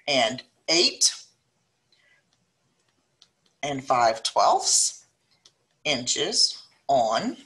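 each word spaced out with long pauses; slow dictation. Acted. Teacher dictating a word problem to students, slow and spaced.

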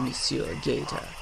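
usual speaking voice

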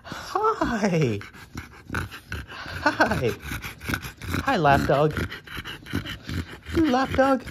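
high, loud cooing greetings. Real, not acted. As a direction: coo the lap dog over. Cooing at dog in lap.

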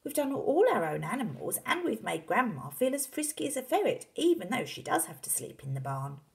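lightly voiced boy, starting high; questioning young son. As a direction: reason with Dad as the boy. Boy character argues with father.

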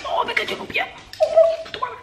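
sudden high yelp of pain. Real, not acted. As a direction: yelp as it hits your foot. Battery fell on her foot.

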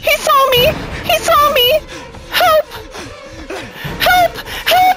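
very high, loud shrieks for help; terrified screams. Real, not acted. Player spotted by the doctor, terrified high screaming. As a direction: scream for help at the top of your voice.

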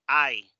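I as the diphthong "ai"; English letter I. Shown as a demonstration. Teacher repeating the English letter, I as "ai". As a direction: say I like English "eye". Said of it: "en inglés se dice I".

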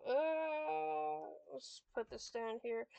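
ordinary talk, nothing marked

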